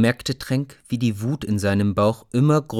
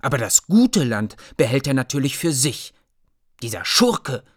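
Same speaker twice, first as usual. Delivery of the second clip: put-on higher little girl's voice, indignant; a higher-voiced indignant little girl; little sister chiming in; 4 semitones higher, 3 dB louder than usual. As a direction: echo her brother's anger like a small child. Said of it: "rief Mia Miene auch".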